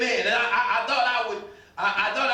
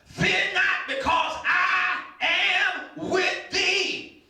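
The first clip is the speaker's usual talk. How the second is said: preaching at full force; 5 semitones higher than usual. Acted. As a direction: rouse the congregation with rising fervour.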